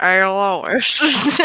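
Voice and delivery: Peter Griffin voice. Retold or imitated. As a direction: call out like a cartoon dad. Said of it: "Peter Griffin bullshit".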